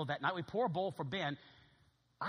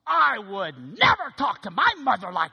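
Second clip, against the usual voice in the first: louder and slow, shocked reproach; Ben scolding; 5 semitones higher, 14 dB louder, slower than usual. Retold or imitated. Ben scolds Mo for talking back, loud and slow. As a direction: tell him off earnestly.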